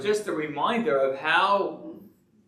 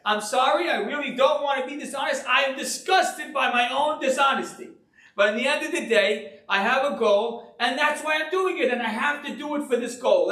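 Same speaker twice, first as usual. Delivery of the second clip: imitating the excuse-maker; 7 semitones higher, faster than usual. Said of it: "They say".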